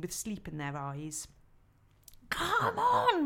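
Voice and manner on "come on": loud, high beaver call; urging them up the bank; cried out. Acted. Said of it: "Mister Beaver cried".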